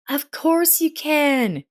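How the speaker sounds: put-on a wife instantly agreeing to take the girl; exclaiming. A narrator's reading. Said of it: "Elsa exclaimed".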